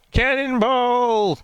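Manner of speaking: cannonball yell. Retold or imitated. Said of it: "when he says cannonball".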